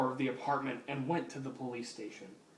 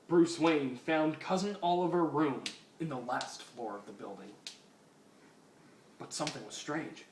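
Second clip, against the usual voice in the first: plain reading voice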